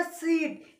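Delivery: "सीट" with the ee held long; long sound. Shown as a demonstration. Teacher repeating the long-sound example, "सीट" stretched. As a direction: draw out the vowel of "सीट". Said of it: "ये long sound है".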